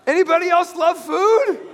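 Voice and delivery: high, loud rallying call; excited question. Real, not acted. Preacher asking the congregation about food, loud excited call. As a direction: whip the crowd up about food.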